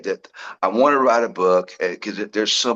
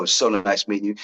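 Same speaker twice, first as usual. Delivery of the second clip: ordinary delivery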